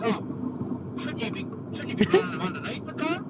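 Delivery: nosy neighbour. Retold or imitated. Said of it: "And he goes".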